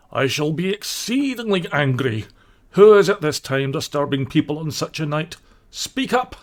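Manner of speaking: a grumpy householder, annoyed, building up; gruff irritated badger. Badger scolds unknown callers, rising annoyance. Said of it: "said a gruff and suspicious voice".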